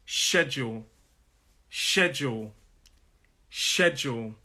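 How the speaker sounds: SHED-jool, "schedule" stressed on the first syllable; careful teacher's "schedule", weight on SHED. Shown as a demonstration. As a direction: stress the start of "schedule", let the second syllable fall. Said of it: "the stress is on that first syllable".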